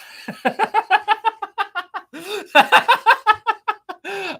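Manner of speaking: long high laugh, helpless and loud; can't stop cracking up. Real, not acted. Streamer laughing his head off at chat jokes.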